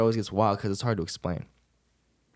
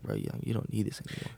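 whisper; 8 dB quieter than usual. Retold or imitated. Spirit's voice speaking inside him.

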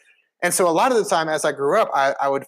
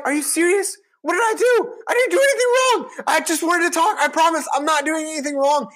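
indignant protest; 14 semitones higher than usual. Retold or imitated. Third grader protesting to the teacher about being put on red, high indignant shout. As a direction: plead your innocence in a squeaky, outraged shout.

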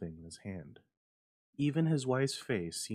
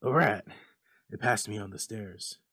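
shaking voice; 7 dB louder than usual. A narrator's reading. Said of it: "said the old man in shaking tones".

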